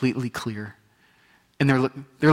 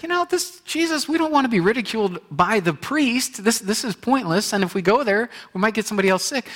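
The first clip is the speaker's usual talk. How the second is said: higher pitched worried lepers; 9 semitones higher, 4 dB louder than usual. Retold or imitated. Lepers' imagined doubts.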